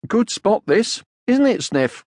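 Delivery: high, loud, put-on casual; fake casual. A narrator's reading. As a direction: say it loud for her to hear. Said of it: "Out loud he said".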